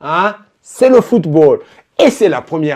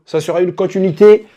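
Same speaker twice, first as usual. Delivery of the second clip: normal talking voice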